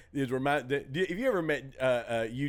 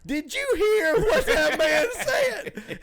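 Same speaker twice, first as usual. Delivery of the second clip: pitched way up and drawn out, slowly, in disbelief; high incredulous squeal; 7 dB louder, slower than usual. Retold or imitated. Comic retelling a friend's bit, high, slow, shocked squeal. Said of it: "And he goes".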